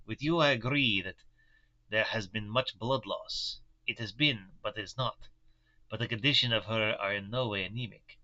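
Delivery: put-on Van Helsing's German accent, grave; thoughtful German professor, measured. Professor reports his careful examination to the doctor, German accent. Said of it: "the voice that I'm working with".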